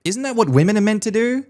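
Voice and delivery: high, prim mum voice pushing for grandkids; mocking nagging mum. Mum scolding the wife for no grandchild, in a high mocking voice. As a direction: play the pushy mother-in-law, pitched high. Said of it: "my mum looked at my wife and said".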